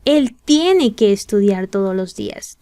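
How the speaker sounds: ordinary delivery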